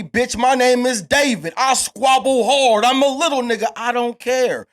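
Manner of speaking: loud, high-pitched fighting talk; defiant boasting. Acted. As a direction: boast like an underdog squaring up for a fight. Defiant boast as David facing Goliath.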